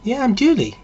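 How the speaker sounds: high-pitched mock-female doll voice; put-on high girlish reply; doll come to life. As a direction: pipe up high as the girlfriend doll. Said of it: "And the thing says".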